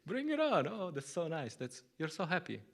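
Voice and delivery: high, bubbling excitement; elated. Retold or imitated. Devotee flying high on Sankirtan, elated and high. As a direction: ride the high of the chanting. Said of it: "You're like".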